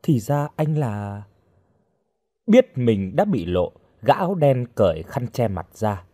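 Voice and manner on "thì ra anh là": slow, dawning realisation left hanging; astonished. A narrator's reading. Rescued girl recognising the masked man, astonished. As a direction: trail off in stunned recognition. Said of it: "Hồng kinh ngạc".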